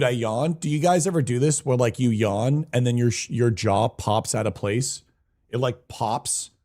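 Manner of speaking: ordinary talk, nothing marked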